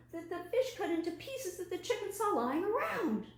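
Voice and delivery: high, loud squeaky frog insisting; excited frog voice. Acted. Frog character insisting, storytelling.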